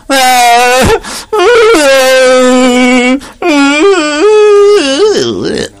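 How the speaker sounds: put-on long high rising baby wail, loud. Baby Krishna wails for his mother, high loud cry.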